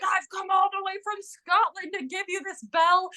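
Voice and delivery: high, shouted mock Scottish brogue; imitating a Scottish brogue, yelling. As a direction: yell it like a proud Scot delivering a gift. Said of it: "in a full Scottish brogue, like, yelling at him".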